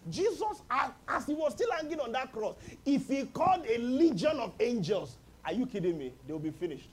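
ordinary talk, nothing marked

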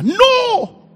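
high, drawn-out "no"; emphatic refusal. Acted. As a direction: cry out no in defiance. Declares exemption over child.

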